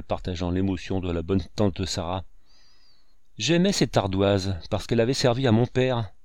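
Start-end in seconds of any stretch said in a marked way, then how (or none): none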